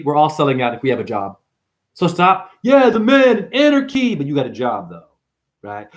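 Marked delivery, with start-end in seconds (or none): 2.6-4.1 high, loud sloganeering voice; hammed-up anarchist battle cry; mock anarchist. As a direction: parody a protester hollering slogans. Mocks self-styled anarchists.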